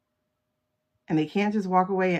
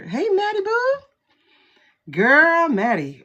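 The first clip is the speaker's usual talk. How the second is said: much higher, bright excited hello in a French accent-free voice; excited greeting; 9 semitones higher, 6 dB louder than usual. Real, not acted. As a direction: squeal a happy hello to a viewer. Greeting a viewer in chat.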